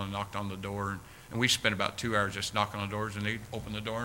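normal talking voice

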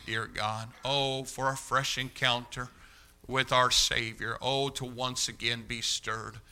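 soft, hushed pastoral prayer. Real, not acted. Pastor praying for the church before the sermon, hushed and earnest. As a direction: pray gently over the congregation.

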